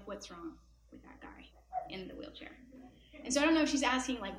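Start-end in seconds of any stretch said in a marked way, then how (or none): 0.1-2.6 soft unvoiced child's whisper; little whispered question. Little girl quietly asking grandpa about the man. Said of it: "asking him in a little voice".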